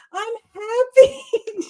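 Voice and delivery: high, slow crying voice; mock sobbing. Telling grandma through mock sobs, high and slow. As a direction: whimper it through tears. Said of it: "I go".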